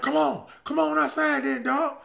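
loud, high call-out; challenging shout. Retold or imitated. Angry ex daring the new boyfriend to step outside, shouting. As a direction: dare him to come outside, shouting. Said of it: "So I'm like".

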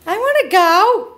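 high, loud whiny voice for the dog; dog's voice. Pretend. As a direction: plead to be let out as the dog. Voicing the escaping dog.

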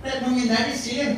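ordinary delivery

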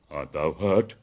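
loud, low, concerned question; concerned bear. A narrator's reading. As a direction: ask with big-bear force. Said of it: "hugging him softly".

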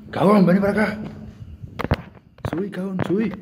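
calling out. Real, not acted. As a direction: shout up to the scared friend. Calling a scared friend upstairs.

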